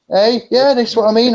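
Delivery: high and loud agreement; excited. Real, not acted. Agreeing with his mate, high and excited. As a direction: burst out in eager agreement.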